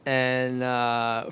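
ordinary talk, nothing marked

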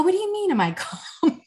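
high-pitched incredulous question with a laugh; retold baffled retort. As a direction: quote yourself reacting in amused disbelief. Retold baffled reply to odd question.

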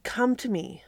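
ordinary talk, nothing marked

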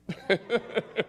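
high giggle on the edge of crying; helpless teary laughter; tearful laugh. Real, not acted. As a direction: laugh till it nearly turns to crying. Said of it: "I'm laughing and crying at the same time".

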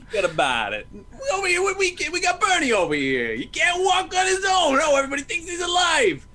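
bellowing like a New Yorker hauling Bernie; New York accent. Acted. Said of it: "that's a spot-on New York accent".

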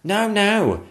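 high, loud protest; protesting footman. Acted. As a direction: object at once. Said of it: "said the second footman".

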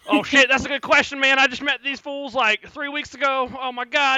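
high, loud, flustered rambling; panicked hillbilly NPC. Acted. Cletus answering the party in a loud panic. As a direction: blurt it out in a panic.

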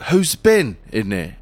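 low-pitched rock star impression. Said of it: "that was my [name] impression".

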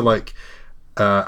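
ordinary talk, nothing marked

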